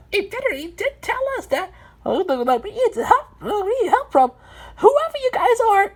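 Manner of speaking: high-pitched character protest. A narrator's reading. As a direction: object in a very high cartoon voice. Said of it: "protested three".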